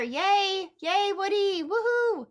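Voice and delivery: high excited cheer. Pretend. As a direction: cheer like a fan. Cheering Woody's arrival.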